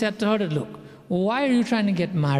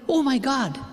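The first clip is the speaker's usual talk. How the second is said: excited; 5 semitones higher than usual. Retold or imitated. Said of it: "They go like".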